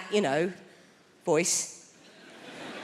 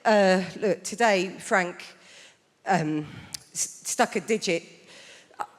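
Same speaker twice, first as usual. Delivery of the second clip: hesitant teacher; 4 semitones lower than usual. Retold or imitated. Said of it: "She said".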